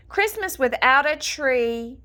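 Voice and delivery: plain reading voice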